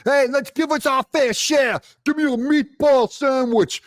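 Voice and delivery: loud mock union boss voice; mocking tough guy.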